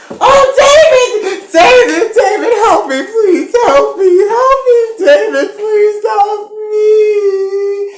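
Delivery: hammed-up high shrieking wail; mock damsel screaming, drawing out the last word; desperate cry for help. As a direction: wail for rescue, very high and loud. Acting Veronica running to David for help.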